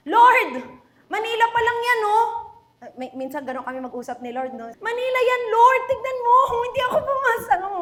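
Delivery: high, loud, fast whining to God; whining complaint. Retold or imitated. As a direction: complain to the Lord like a sulking child. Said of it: "And then I told God". (mataas at mabilis na pagmamaktol)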